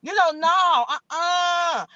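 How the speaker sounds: playful scolding; sassy dismissal. Real, not acted. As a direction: wave them off with attitude. Calling out a rival, dismissing.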